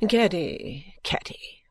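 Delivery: untroubled mother, fading to a whisper; complacent. A narrator's reading. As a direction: chide the daughter placidly, second name hushed. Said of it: "with the greatest complacency".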